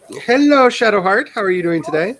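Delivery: loud high hello easing down; high-pitched welcome to a newcomer; high greeting. Real, not acted. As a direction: sing out a high, cheery greeting. Greets a newcomer, then retries it.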